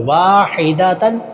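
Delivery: वाहिदतन slow with waa held long; lengthened waw. Shown as a demonstration. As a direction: draw out the first vowel of वाहिदतन. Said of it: "वाव को लंबा करेंगे". (वाहिदतन का 'वा' लंबा)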